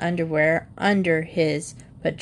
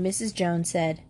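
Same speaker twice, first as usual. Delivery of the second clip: ordinary delivery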